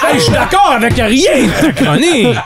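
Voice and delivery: laughing. Real, not acted. Group laughs at joke.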